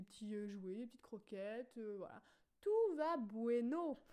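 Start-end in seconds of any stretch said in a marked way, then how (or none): 2.6-4.0 "Tout va bueno" drawn out slowly in a hammy mock Spanish accent. Telling viewers all is fine, in mock Spanish accent. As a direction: stretch it like a cartoon Spaniard. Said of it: "mon accent espagnol".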